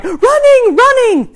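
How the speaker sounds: put-on high, loud toddler chant; mock little-kid announcement; imitating shouting toddler. Acts out toddler's shouting cycle.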